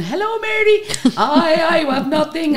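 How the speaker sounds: high, lightly voiced excited customer, with a laugh; customers greeting. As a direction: squeal it like an eager fan at the till. Customers greeting her at till.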